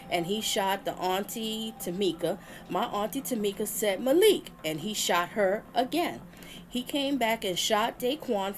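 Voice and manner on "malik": higher, louder call of the name "Malik". Retold or imitated. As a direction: call out his name in alarm. Auntie calls out to shooter.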